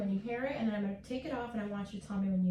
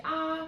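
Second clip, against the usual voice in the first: open ah sound; 6 semitones higher, 5 dB louder than usual. Shown as a demonstration. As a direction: say a long open "Ah". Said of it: "can you say".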